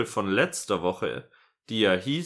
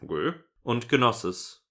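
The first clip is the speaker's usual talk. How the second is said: ordinary talk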